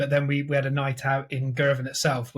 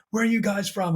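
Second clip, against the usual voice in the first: retold challenge; 5 semitones higher than usual. Acted questioning by approaching hoodlums.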